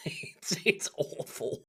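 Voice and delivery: whispered through laughter; it's awful, almost unvoiced from laughing; breathless laugh. Real, not acted. Laughing off how bad the film is, breathy.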